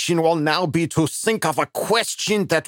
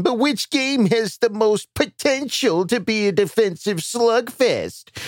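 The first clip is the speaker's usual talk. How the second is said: ordinary talk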